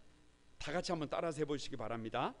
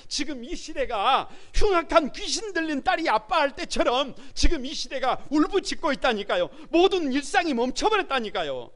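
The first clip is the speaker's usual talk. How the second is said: higher and much louder impassioned sermon; preaching at full force; 8 semitones higher, 9 dB louder than usual. Acted. Pastor urging the congregation to cry out, full force.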